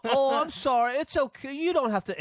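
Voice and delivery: high, apologetic put-on British voice; polite British woman. To the oversharing American, a high mock British apology. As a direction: fluster politely to smooth it over. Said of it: "Then the British people go".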